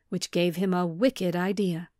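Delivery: plain reading voice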